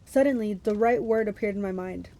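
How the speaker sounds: ordinary delivery, nothing marked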